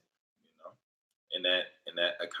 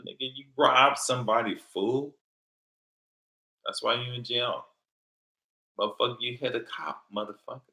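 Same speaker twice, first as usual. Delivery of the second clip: scolding rant; 5 semitones higher, 5 dB louder than usual. Acted. Scolding those inmates, rising anger.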